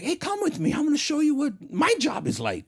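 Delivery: high excited invite; voicing his friend. Friend in Brazil calling him along, voice pitched high.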